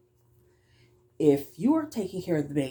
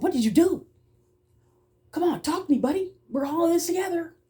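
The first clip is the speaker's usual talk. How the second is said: much higher, gentle coaxing voice; coaxing the child; 12 semitones higher, 4 dB louder than usual. Retold or imitated. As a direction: coax the little boy to talk, sweetly and high. Coaxing an aggressive toddler.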